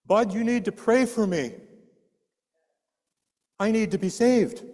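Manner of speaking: high, loud, urgent begging; father crying out to his son, desperate and loud; desperate plea. Retold or imitated. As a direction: plead for your soul. Dying father begging for prayer.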